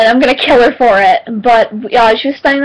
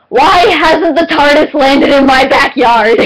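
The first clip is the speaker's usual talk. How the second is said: loud, slow, higher-pitched mock wail; mock exasperated plea; 4 semitones higher, 4 dB louder, slower than usual. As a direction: cry it out in playful despair. Mock plea to an unanswerable question.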